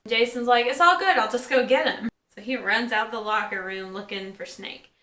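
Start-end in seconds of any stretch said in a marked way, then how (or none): 0.7-2.1 bright, higher and louder, breezy; upbeat quoting. Retold or imitated. Vlogger voicing Jason as he heads off to find Snake, higher and louder, carefree. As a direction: toss it off, cheerful and easy.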